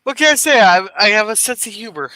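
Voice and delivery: ordinary talk, nothing marked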